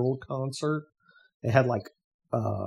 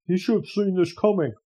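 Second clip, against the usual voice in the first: mock pundit; 7 semitones higher, 4 dB louder than usual. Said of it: "that's exactly their tone of voice".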